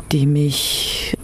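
ordinary delivery

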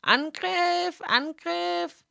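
very high, frantic battle cry; Fräulein Zwang. Acted. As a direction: shriek it like a tiny general. Fräulein Zwang, hohe Stimme. (Fräulein Zwang ruft sehr hoch zum Angriff)

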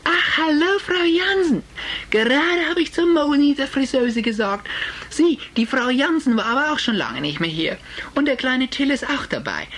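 hohe, fremde Tratschstimme einer Friseuse. Acted. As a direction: spiel die neugierige Klatschfriseuse ganz hoch. Friseuse Frau Jaschke plaudert. (Friseuse begrüßt Frau Jansen, hoch und geschwätzig)

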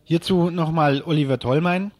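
ordinary delivery

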